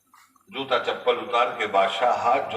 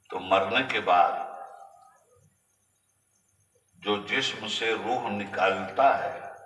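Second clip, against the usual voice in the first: slow, weighty; 4 semitones lower, 5 dB quieter, slower than usual. Real, not acted. Preacher slows for weight.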